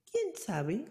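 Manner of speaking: put-on high, kindly old woman; a curious old lady asks. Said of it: "respondió la vieja".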